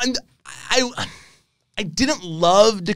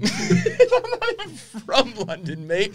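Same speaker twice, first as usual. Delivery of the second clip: put-on lightly done, laughing British accent, much higher and slow; mock British accent; 6 semitones higher, 6 dB quieter, slower than usual. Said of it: "a shitty British accent".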